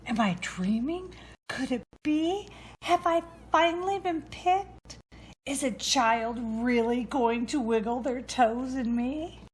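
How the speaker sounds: can't believe it's finally happening; excited disbelief. Acted. Boot finally lifted from the closet floor, breathless hope. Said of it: "The little brown boots' excitement".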